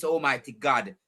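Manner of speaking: usual speaking voice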